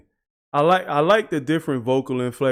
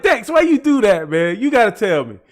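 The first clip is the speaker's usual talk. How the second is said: high, loud plea to the rapper, half laughing; high, loud half-laughing why; excited disbelief; 6 semitones higher, 5 dB louder than usual. Real, not acted. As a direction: cry out why did he do that, laughing.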